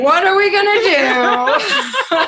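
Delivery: high, slow, laughing panic. Retold or imitated. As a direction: wail it through laughter. Panicked couple at home.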